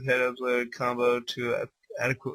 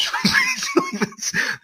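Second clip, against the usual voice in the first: very high, disbelieving laugh; squealing laugh; 5 dB louder than usual. Real, not acted. Reader laughs at the silly repeated line, squealing. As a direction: let out a shrieking giggle.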